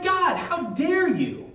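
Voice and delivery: high, drawn-out indignant outburst; pissed-off Jonah. Acted. Preacher voicing Jonah indignant at God, high-pitched outrage. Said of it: "Jonah's pissed about it".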